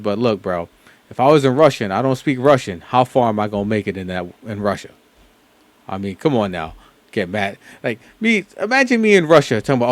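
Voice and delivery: ordinary talk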